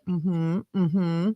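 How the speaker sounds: normal talking voice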